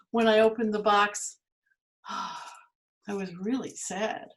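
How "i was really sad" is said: softer sad sigh. Acted. Pandora saddened.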